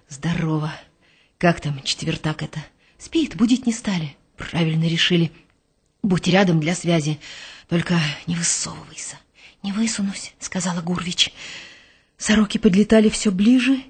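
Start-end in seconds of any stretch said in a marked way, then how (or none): 0.1-9.2 low, hushed soldier's voice; a quiet commander giving orders; hushed sergeant major. Acted. Голос старшины в засаде. 9.6-10.3 barely audible reply; quiet reply. Acted. Гурвич отвечает шёпотом.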